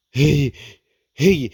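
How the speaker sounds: sneezes rising to a burst; sneeze windup. Real, not acted. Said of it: "they're coming".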